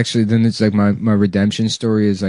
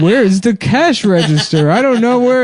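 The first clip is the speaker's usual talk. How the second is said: high, confused whine; hammed-up confusion; fake confused shopper; 10 semitones higher than usual. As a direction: act lost, pitch way up. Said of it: "like, gesturing".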